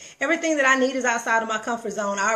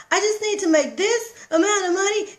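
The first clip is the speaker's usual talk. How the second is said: higher, lightly voiced daughter; mock the daughter's money worry in a higher voice; imitating daughter; 10 semitones higher, 4 dB louder than usual. Mom mimics her daughter complaining about money, higher mock voice.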